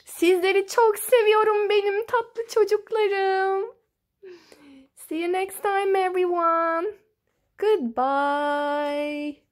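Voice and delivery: high, loud, gushing send-off; excited fond farewell. Real, not acted. Storyteller saying goodbye to beloved children, loud excited farewell. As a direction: call out an affectionate goodbye, big and high.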